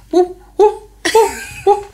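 high squeaky whoop, rising each time; guinea pig voice. Shown as a demonstration. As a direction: squeak like a deaf old lady's guinea pig call. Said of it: "make a sound kind of like a guinea pig, like this".

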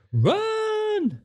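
long, very high-pitched "run"; mock alarm cry. Friend urging an escape from her, high mock alarm cry. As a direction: sing out a drawn-out mock warning.

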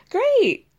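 high, loud mock-bright Great; sarcastic great. [name] answering Joel's confession with mock sarcasm. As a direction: fake cheer over hurt.

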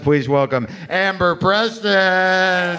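drawn-out, big stage welcome; announcer intro. Real, not acted. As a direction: stretch out the name like an emcee. Host introduces comedian.

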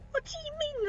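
gasping and high-pitched; gasp, offended and stunned; shocked gasp. Acted. Bee gasps in offense.

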